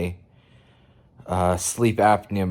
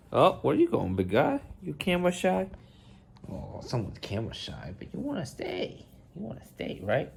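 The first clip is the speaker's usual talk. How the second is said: pet talk; 6 semitones higher, 7 dB quieter than usual. Real, not acted. Coaxing the camera-shy cat, soft baby talk.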